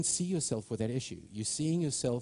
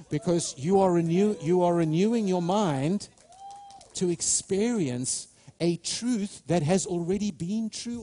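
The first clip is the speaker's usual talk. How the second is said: loud, high, driving preacher's climax; rising, shouting sermon peak; preaching at full force; 5 semitones higher, 4 dB louder than usual. Acted. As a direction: build the fire in the congregation.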